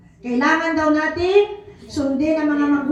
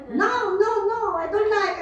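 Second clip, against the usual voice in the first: Aga impression; 6 semitones higher than usual. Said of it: "Parang si Aga".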